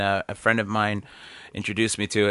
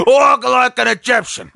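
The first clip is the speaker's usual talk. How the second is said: belted high and loud like a hit chorus; shouted song title; 6 semitones higher, 3 dB louder than usual. Pretend. Pitching the spoof show to the audience, a loud belted title. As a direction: sell the eighties hit with a big shout.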